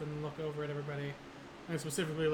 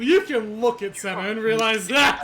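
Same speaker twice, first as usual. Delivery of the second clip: shouting; 7 semitones higher, 13 dB louder than usual. Real, not acted. Player shouting joke at table.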